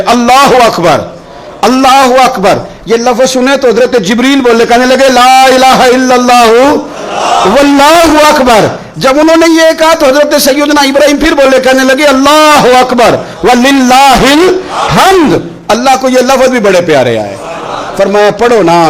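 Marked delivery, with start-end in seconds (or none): 0.1-2.6 high-pitched takbir call. Retold or imitated. Ismail answers his father's takbir, called out high. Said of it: "جناب اسماعیل بھی پکارے". 2.9-17.4 impassioned preaching. Acted. Preacher retells Jibreel and Ibrahim's praise, impassioned chanting.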